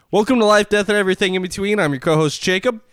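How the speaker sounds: loud, upbeat show host; announcer intro. Acted. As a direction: open the show with energy.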